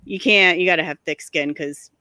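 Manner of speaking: normal talking voice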